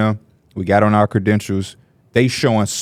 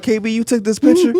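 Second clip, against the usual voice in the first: imitating Mike; 9 semitones higher than usual. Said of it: "He like".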